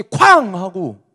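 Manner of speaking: loud, high booming "쾅"; sudden bang. Shown as a demonstration. Preacher startling dozing listeners with a crash, suddenly loud. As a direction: burst out with a drawn-out crash.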